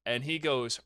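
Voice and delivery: normal talking voice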